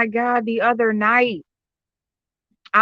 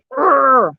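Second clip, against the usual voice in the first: loud drawn-out "grr"; frustrated growl; 4 semitones higher, 5 dB louder than usual. Acted. Growls at failed subscription payment.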